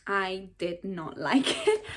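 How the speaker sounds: slow, word-by-word denial broken by a laugh; slow emphatic verdict. Real, not acted. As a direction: spell out the dislike slowly, laughing midway. Blunt verdict, laugh breaks in.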